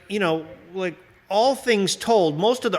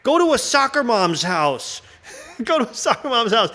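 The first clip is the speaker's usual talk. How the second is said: protester yelling; 9 semitones higher than usual. Pretend. Protester at the landing site shouting at aliens. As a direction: yell it like a sign-waving protester. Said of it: "holding a sign".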